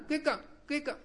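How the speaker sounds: peacock call. Retold or imitated.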